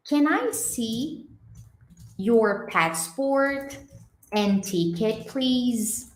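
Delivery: very slow, each word spaced out; slow careful English. Shown as a demonstration. Teacher slowly modelling a sentence for students. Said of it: "Então, fica assim, ó".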